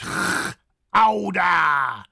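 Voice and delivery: Gouda with a long throat scrape; loud, slow "khhh-owda" for Gouda; harsh G. Shown as a demonstration. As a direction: separate the rasped g in Gouda. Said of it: "the harsh G".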